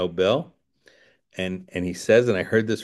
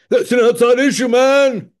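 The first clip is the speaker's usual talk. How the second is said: sudden high, loud exclaimed voice, a put-on character; mock outburst; 9 dB louder than usual. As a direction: blurt it out loud and high like someone else. Sudden exclaimed aside in a talk.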